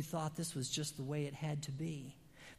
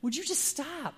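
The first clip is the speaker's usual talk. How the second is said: exasperated call, higher and louder; 10 semitones higher, 4 dB louder than usual. Retold or imitated. Preacher retelling yelling at a neighbor who ignores red lights, exasperated. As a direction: yell across the street at him. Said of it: "and I say".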